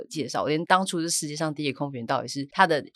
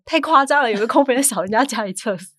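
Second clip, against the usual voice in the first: incredulous exclaim; 9 semitones higher, 9 dB louder than usual. Retold or imitated. Friends exclaim at toilet cleaning.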